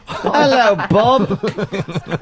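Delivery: ordinary delivery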